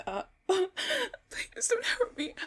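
high, loud, tearful rush; panicked pleading. Acted. Victim telling mugger she has no cash, panicked pleading. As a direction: babble in rising terror.

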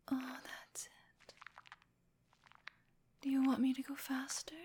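normal talking voice

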